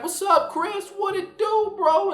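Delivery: high, loud bro-style greeting; imitating a hyped-up friend. Nash greeting the speaker as an old pal, high and loud.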